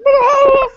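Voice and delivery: sudden high, loud hammed-up scream; mock scream. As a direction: shriek like the alien is bursting out. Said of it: "the guy starts screaming".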